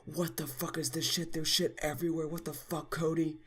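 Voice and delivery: both parents in a high mock yell; mock scream. Parents screaming at Cody about the carpet, high mock yell. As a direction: shriek it like the angry parents.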